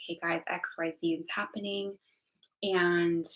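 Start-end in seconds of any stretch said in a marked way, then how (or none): 0.1-2.0 whispered retelling of telling friends; whispered version of the same line to friends. Retold or imitated. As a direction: hush it as if confiding a secret. Repeats the same words whispered.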